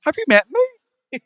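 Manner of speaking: high, slow, laughing incredulity; laughing disbelief. Real, not acted. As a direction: laugh it out, amazed. Laughing at cardigan question.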